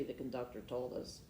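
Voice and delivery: usual speaking voice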